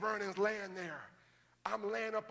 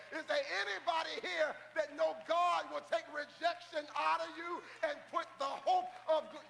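full-force preaching; 8 semitones higher than usual. Real, not acted. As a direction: whoop and preach to the crowd.

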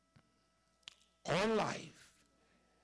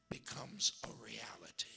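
whisper; 8 semitones lower, 8 dB quieter than usual. Real, not acted. Preacher drops to hushed aside.

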